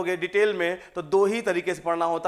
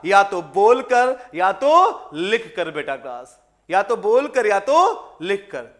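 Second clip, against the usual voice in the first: slow and loud, बोलकर and लिखकर each hammered out; emphatic dictation; 6 dB louder, slower than usual. Shown as a demonstration. Teacher stressing the two means of language to the class, slow and loud. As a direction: drive the two ways home, word by word. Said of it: "क्या?".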